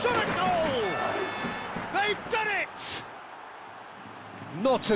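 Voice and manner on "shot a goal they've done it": high, soaring goal shout; goal call. Real, not acted. Commentator screaming the goal to the crowd, high and thrilled. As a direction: erupt as the ball hits the net.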